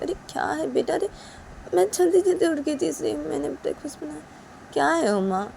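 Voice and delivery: mother-in-law calming an upset Uma; mother placating. Acted. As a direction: brush off the fuss. Mother soothing.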